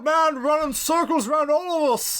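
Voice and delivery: hamming up the impression while the cohost laughs; loud Brosnan impression. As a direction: keep booming like a scenery-chewing Pierce Brosnan. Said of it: "talks like this very loud".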